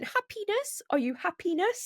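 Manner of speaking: imitating Johan, high and questioning; Johan asking. Teasing a friend on a podcast, high mock Johan voice. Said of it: "He kept saying".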